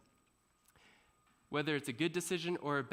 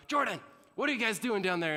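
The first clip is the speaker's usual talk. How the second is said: louder, higher call down the stairs; mom yelling down; 7 semitones higher, 6 dB louder than usual. Retold or imitated.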